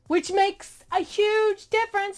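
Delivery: real exasperated telling-off; indignant rant. Real, not acted. Ranting at misquoting preacher.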